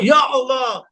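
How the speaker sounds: called out prayer. Shown as a demonstration. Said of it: "panggil nama Allah itu".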